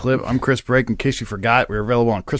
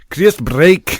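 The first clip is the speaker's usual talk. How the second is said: mimicked Scottish host, higher and louder; Scottish accent; 4 semitones higher, 5 dB louder than usual. Mimicking the Scottish host's way of saying the name, Scottish accent. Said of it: "I need to isolate that".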